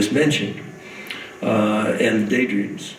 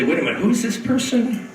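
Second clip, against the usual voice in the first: higher-pitched puzzled question; confused reader; 8 semitones higher than usual. Acted. A reader lost in the book, puzzled and high-pitched. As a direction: ask it like a baffled reader losing the thread.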